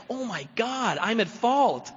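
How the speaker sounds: the shock of seeing his own fault; mock-amazed discovery; stunned realization. Spiritual awakening realization.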